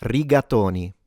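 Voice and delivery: rigatoni said like a real Italian; "rigatoni" with lightly trilled r and stressed to; real Italian pronunciation. Shown as a demonstration. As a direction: model native Italian "rigatoni" for learners. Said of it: "to pronounce this like a real Italian".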